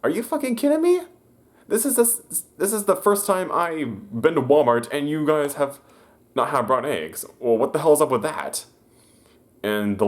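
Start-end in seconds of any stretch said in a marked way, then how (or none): 0.0-8.7 high, shrill mock outrage from an old lady; hammed-up angry old lady voice. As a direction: play the furious customer, pitched way up. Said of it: "old lady was like".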